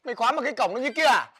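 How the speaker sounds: giận dữ mắng em. Acted. Anh trai mắng em quên khóa cổng.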